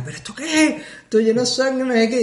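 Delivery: agudo, sin subir el volumen; chillido imitado de novia. Retold or imitated. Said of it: "empieza mi novia ahí a chillar". (chillido fingido, muy agudo)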